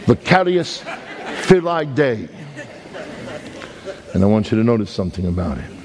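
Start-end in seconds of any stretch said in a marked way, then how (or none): 0.0-2.3 Brooklyn Latin. Shown as a demonstration. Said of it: "That's Latin with a Brooklyn accent".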